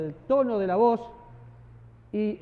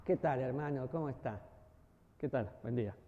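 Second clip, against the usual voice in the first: lower, softer casual greeting; low friendly greeting; 9 semitones lower, 8 dB quieter than usual. Shown as a demonstration. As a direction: greet a brother softly and low. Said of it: "tu tono de voz es importante".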